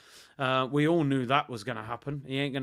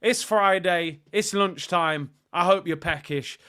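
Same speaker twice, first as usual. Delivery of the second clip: ordinary talk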